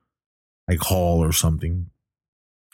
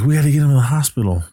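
fast and higher, pressing; urgent; 7 semitones higher, 3 dB louder, faster than usual. Retold or imitated. Friends insisting Travis needs a hospital, fast and urgent. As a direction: hurry them along, rushing the words.

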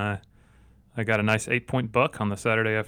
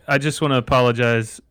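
ordinary talk, nothing marked

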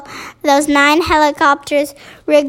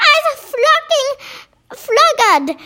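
very high mock scream; giant's scream; 11 semitones higher, slower than usual. A narrator's reading. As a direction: scream it high without real volume, for a story. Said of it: "screamed the main masher".

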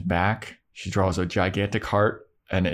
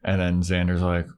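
ordinary talk

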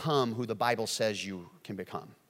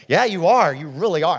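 loud, higher overdone flattery; laying it on; 3 semitones higher, 10 dB louder than usual. Retold or imitated. Pastor retelling his flattering reply, overdone agreement. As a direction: pour on fake praise. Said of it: "I'm just laying it on 'em".

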